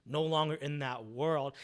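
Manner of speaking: ordinary talk, nothing marked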